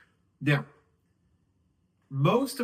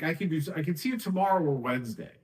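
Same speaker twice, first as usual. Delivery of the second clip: fast, rushed "I can see you tomorrow or Wednesday"; rushed offer; much faster than usual. Shown as a demonstration. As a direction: rattle the times off in one breath. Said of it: "And you say it really fast".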